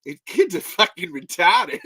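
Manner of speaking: put-on Aussie accent on edge of laughing; Australian accent. Shown as a demonstration. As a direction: say it Australian, about to crack up. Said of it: "I'm gonna do it again".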